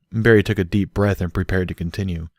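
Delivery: usual speaking voice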